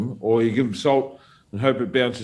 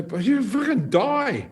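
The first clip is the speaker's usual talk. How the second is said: high, slow, exasperated plea; alarmed warning; 11 semitones higher, slower than usual. Retold or imitated. Warning Sonny who insists on competing, alarmed. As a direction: act out alarm at a friend's stubbornness. Retold warning to cramping friend.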